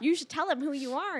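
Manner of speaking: high, loud, laughing friend voice. Retold or imitated. Friends teasing her to reveal her fame, laughing. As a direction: tease her through laughter. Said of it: "All my friends were like".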